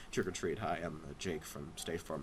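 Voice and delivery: very low, soft deadpan greeting; Jake from State Farm. Acted. Costumed guest at the door trick-or-treating, low character voice. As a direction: trail down low and quiet. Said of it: "I'm like".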